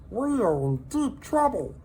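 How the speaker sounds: high little creature voice, gently pitying; a tiny pet breaking hard news kindly; sympathetic salamander voice. A narrator's reading. Minton consoling lonely Hector, high sympathetic voice. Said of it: "he said".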